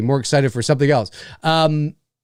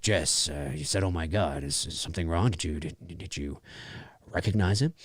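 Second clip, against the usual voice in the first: much lower and softer questioning; low cop voice; 12 semitones lower, 12 dB quieter than usual. Retold or imitated. Retelling the cops questioning Jess, low and soft. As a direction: drop deep and quiet like a cop asking. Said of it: "the cops are like".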